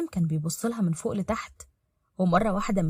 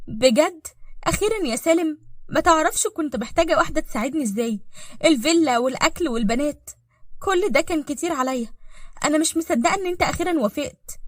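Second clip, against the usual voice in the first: higher-pitched happy surprise; delighted relief; 6 semitones higher than usual. Acted. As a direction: gush with relief at the long-awaited yes. Ehefrau freut sich über die Zusage.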